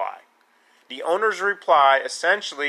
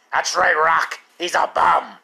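put-on hoarse, rasping old-trainer bark; hammed-up croaky boxing coach caricature; Mickey; 4 dB louder than usual. Answers Rock as his trainer Mickey.

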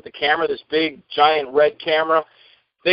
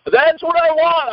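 much higher, slow roof-raising delivery; booming actor; 11 semitones higher, 3 dB louder, slower than usual. Shown as a demonstration. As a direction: belt it so the whole city hears. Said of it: "All of Saint Petersburg is gonna hear me".

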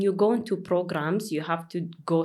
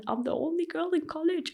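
retold near tears; 11 semitones higher, much faster than usual. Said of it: "I'm this close to crying".

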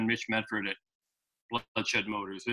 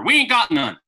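higher, louder Southern flat refusal; a light Southern junkyard voice saying no; mock Southern accent; 7 semitones higher, 5 dB louder than usual. Yard man telling caller there's none, light Southern accent. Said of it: "I can't do a good Southern accent".

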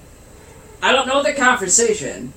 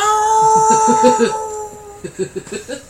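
high, loud burst of laughter; 3 dB louder than usual. Real, not acted. Laughing after roasting his brother's rainbow hair. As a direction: cracks up at his own roast.

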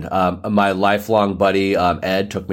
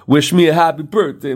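excited; 9 semitones higher, 5 dB louder than usual. Pretend. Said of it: "be like".

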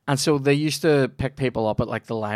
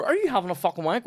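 high, fast incredulous demand; hammed-up indignant question; mock outraged question; 9 semitones higher, faster than usual. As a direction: blurt it out in disbelief. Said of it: "turn around and go".

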